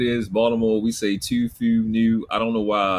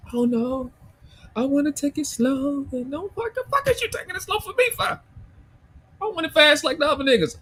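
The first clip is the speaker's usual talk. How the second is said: much higher, sing-song mocking of a hesitant woman; mock girly voice.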